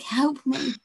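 high, theatrical plea for help; dramatic damsel. Pretend. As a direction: play a swooning damsel in distress. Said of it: "really dramatic pose of".

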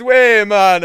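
put-on high, loud Jamaican accent. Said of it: "do that Jamaican accent again".

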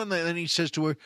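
ordinary talk, nothing marked

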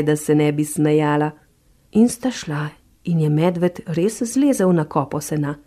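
plain reading voice

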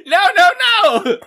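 loud high refusals broken by laughter; laughing protest. Real, not acted. Co-host rejecting the inside man theory, laughing protest. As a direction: cry it out, cracking up.

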